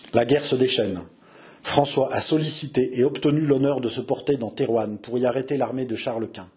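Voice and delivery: ordinary delivery, nothing marked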